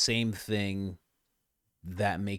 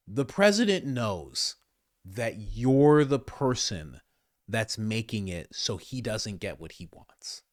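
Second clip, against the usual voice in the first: ordinary talk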